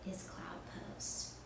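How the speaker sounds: soft whisper; calm bedtime whisper. Acted. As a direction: hush them into rest. Winding down to rest.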